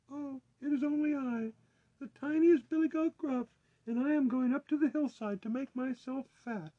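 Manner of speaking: tiny, very high billy goat; small voice. A narrator's reading. Littlest goat answering the troll, tiny high voice. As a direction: squeak it in a little falsetto. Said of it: "with such a small voice".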